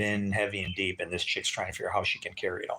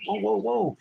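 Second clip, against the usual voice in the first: high, startled whoa; money flying in the air, startled; 11 semitones higher than usual. Retold or imitated. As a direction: jump back in alarm. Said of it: "I was like".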